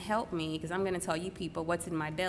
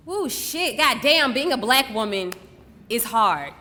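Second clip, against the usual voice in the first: loud, higher, heartfelt exclamation; exasperated outburst; 4 semitones higher, 9 dB louder, slower than usual. Acted. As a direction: burst out of the poem in exasperation. Exasperated outburst within the monologue.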